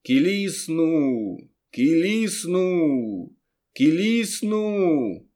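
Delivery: long, high wailing call; drawn-out calling shout. A narrator's reading. Conjurer calling out a spell before the crowd, drawn-out cry. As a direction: stretch each word out like a conjurer's cry. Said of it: "Потім, як закричу".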